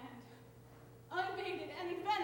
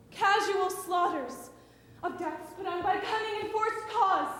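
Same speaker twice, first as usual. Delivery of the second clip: declaiming formal speech; 7 dB louder than usual. Acted.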